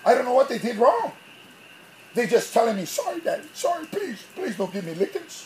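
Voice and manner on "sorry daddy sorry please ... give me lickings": high, soft imitation of a sorry kid; pleading child. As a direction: plead like a little kid dodging punishment. Imitates his kids begging off punishment.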